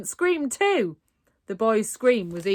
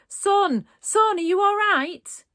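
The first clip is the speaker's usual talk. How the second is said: higher, louder worried call from another room; coughing, spluttering dad; 13 semitones higher, 6 dB louder than usual. A narrator's reading. Dad calling from next room, worried and spluttering. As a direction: call through the wall to your son. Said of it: "he coughed and spluttered".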